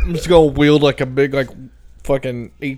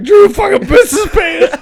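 excited; 8 dB louder than usual. Real, not acted. Laughing retelling of friend.

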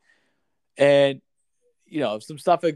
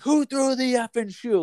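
much higher, heated imitation of the demand; angry demand; 10 semitones higher than usual. Kid hit by the shoe demands answers, angrily. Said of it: "Like, he was pissed".

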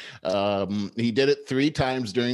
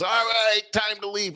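bossy handler; 11 semitones higher than usual. Retold or imitated. Somebody pushing the cameraman out, high mock announcement. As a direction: imitate someone hustling people out. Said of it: "somebody comes into the view of the camera and says".

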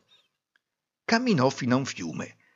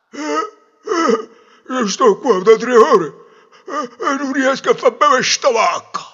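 high, loud, strained simpleton voice; struggling peasant; 13 semitones higher, 10 dB louder than usual. Acted. Farmer explaining his thirsty cow trouble, strained peasant voice. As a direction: complain with effort between grunts. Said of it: "un contadino che s'affannava".

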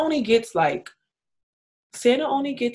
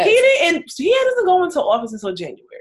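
loud correction; 9 semitones higher, 8 dB louder than usual. Real, not acted. Loud correction in heated chat.

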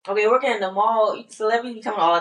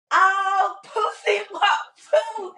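excited mama; 10 semitones higher, slower than usual. Retold or imitated. Mama excited over FaceTime.

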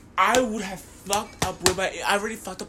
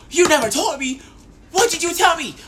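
high, loud angry rant; outraged confrontation; 7 semitones higher, 5 dB louder than usual. Acted. Storyteller confronting T A over a hidden girlfriend, outraged shout. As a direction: shout the accusation, pitched up. Acted rant at boyfriend's confession.

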